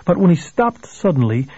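usual speaking voice

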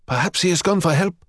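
a junior eager to excuse himself; nervous gulp. Acted. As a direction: Mikhail gulping out a hopeful excuse. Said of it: "Mikhail gulped".

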